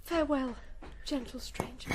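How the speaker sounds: fading farewell. Acted. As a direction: say goodbye as you slip into the dark. Princess flees down secret steps.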